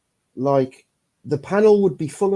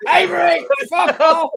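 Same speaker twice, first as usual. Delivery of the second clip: mock snap; 13 semitones higher, 3 dB louder than usual. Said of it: "if you're like".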